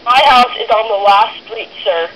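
normal talking voice

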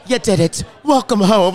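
retold cheer in an Irish woman's voice. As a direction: imitate an Irish woman's hearty welcome. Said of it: "this Irish woman was like".

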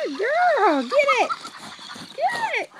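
very high, loud pet-talk squeal; excited praise. Real, not acted. Owner urging good girl after toy, excited. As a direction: cheer the dog on, high and loud.

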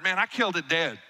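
ordinary talk, nothing marked

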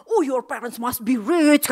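loud, slow, put-on gushing exclamation; hammed-up amazement at rich parents; mock envious exclaim. Stranger marvelling at the rich student, loud and slow.

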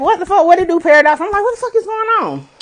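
high-pitched, bewildered reenactment; mock-panicked disbelief; retold alarm. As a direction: voice climbs as you act out the confusion. Heart racing, retold panicked confusion.

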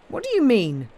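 high, loud, joking question; jocular. A narrator's reading. Inspector teasing the smith, jocular. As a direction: toss it out as banter. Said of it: "asked the inspector jocularly".

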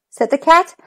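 usual speaking voice

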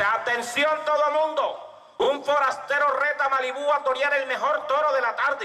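high, drawn-out public proclamation; bullring announcer. Acted. Announcer challenges Malibú before the crowd, high proclamation. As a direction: call it out to the whole crowd.

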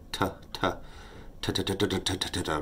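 quick unvoiced "tuh, tuh, tuh" tongue pops; whispered tuh syllables. Shown as a demonstration. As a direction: whisper "tuh" fast, tongue against the roof of the mouth. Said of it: "you just wanna say".

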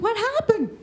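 surprised. Retold or imitated. Reply to friend's late call.

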